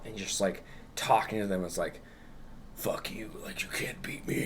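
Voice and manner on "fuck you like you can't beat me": a voiceless, taunting whisper from the root; creepy whisper. Retold or imitated. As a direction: hiss the threat like a hidden monster. Giant root taunting the heroes.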